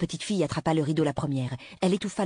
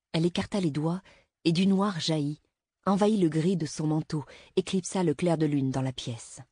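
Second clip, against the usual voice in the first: ordinary delivery, nothing marked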